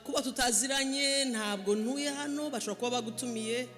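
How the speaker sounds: usual speaking voice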